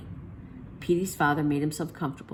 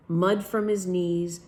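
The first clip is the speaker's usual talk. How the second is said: plain reading voice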